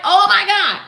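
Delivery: high, loud burst of amazement; amazed exclamation. Acted. When it worked against all doubt, amazed shout. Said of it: "And you're like".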